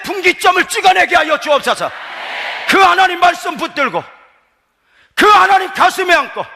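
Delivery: loud, high, fervent prayer shout; impassioned revival preacher cry; full-force preaching. Real, not acted. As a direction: preach it at the top of your voice.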